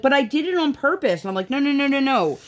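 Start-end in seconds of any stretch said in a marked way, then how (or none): 0.0-1.2 high-pitched imitation of a small child; lightly mimicked kid voice; daughter's protest. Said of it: "So she's like". 1.5-2.4 hasty correction. Retold or imitated. Parent hurriedly correcting child, retold.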